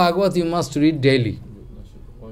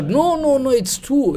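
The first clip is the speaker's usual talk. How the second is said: protesting; 9 semitones higher than usual. Retold or imitated. Retold refusal to cook again.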